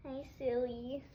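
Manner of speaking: ordinary delivery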